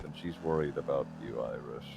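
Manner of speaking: low, softly loaded urging; hushed, deliberately weighted advice; pointed, odd emphasis. Real, not acted. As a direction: drop low and soft, nudging meaningfully. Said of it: "Why are you saying it like that".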